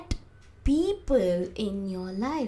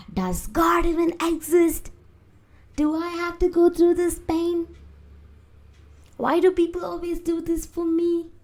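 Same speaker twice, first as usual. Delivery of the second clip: imitating mom, raised high-pitched complaint; mom's despairing rant; 9 semitones higher, 4 dB louder than usual. Retelling own mom questioning God, high loud lament. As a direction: cry out to God as a fed-up mom, high and sustained. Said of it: "my own mom saying that".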